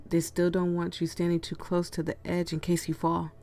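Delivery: usual speaking voice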